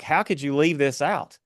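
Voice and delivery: ordinary talk, nothing marked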